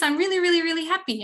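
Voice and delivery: gushing cheerful teacher demo; happy voice. Shown as a demonstration. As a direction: sound really, really happy. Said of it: "when I'm talking like this".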